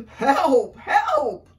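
very loud, high hooting cry; trumpeting. Acted. Elephant fleeing the burrow, loud trumpeted cry. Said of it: "trumpeted Elephant".

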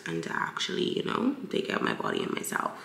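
low, husky morning voice; just woke up, groggy voice. Real, not acted. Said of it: "I'm sorry for my morning voice".

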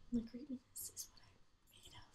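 breathy hushed boast; mock grand whisper. Bragging to viewers in a hushed mock-grand whisper. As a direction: whisper it like a secret brag.

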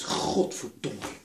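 ordinary talk, nothing marked

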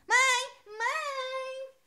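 high whiny call for mum. Acted. Little girl calling her mother to complain, high whine.

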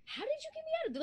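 high shocked question; disbelieving squeak; stunned question. Acted. Woman shocked to be out, asking how.

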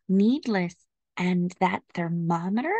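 usual speaking voice

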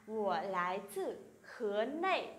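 slow, careful Mandarin question; slow, syllable by syllable Chinese demo; slow model reading. Shown as a demonstration. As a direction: read it out slowly for students to copy. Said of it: "sẽ là".

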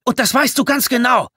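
loud, high, angry yell; screaming. A narrator's reading. Tommy telling Vic he knew the danger, screaming. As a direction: shout it as an accusation. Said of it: "schrie Tommy".

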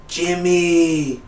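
the mother reaching out for her son, high and faint; frail mom calling. Retold or imitated. Dying mom calling for Jimmy, a lightly voiced high cry.

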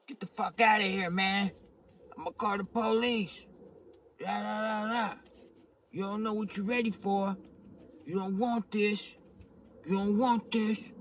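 high-pitched, mocking imitation of a man warning someone off; squeaky-high retold threats; mimicked defensive man. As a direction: put on a high voice behind a locked door. Acted man behind door, threatening.

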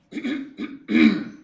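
loud, pointed throat clear. Acted. Older scientist about to interrupt a lecture, pointed throat clearing. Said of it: "clears his throat".

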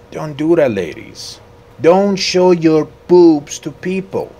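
high, loud telling-off; mock scolding. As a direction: scold them loudly, playfully. Scolding passers-by in the game.

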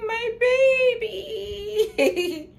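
high, slow cooing with a laugh; cooing baby talk. Retold or imitated. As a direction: melt over the kitten, cooing. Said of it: "I was like".

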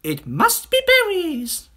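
very high, loud, drawn-out bird; singsong bird voice. Acted. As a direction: sing it out like an excited little bird. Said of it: "sang the roly-poly bird".

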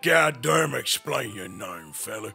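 put-on Western cowboy accent, gruff and teasing. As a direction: play the old-West cowboy mocking a name. Holds a cowboy voice all along.

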